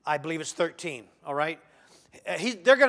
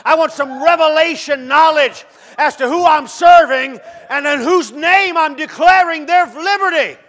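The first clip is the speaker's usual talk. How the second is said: Moses demanding; 9 semitones higher, 14 dB louder than usual. Acted. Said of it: "He said".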